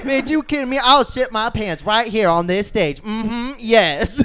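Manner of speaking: high, loud hyped-up comic voice; Scat Williams impression. Comic on stage bragging to the crowd, high impression. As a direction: boast like a brash stand-up on stage.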